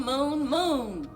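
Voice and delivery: usual speaking voice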